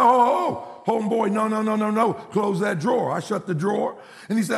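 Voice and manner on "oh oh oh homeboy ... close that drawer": higher, mock-stern father cutting in; acted dad putting a stop to it; halting objection. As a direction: stop him in his tracks with rapid objections. Father halts son packing clothes.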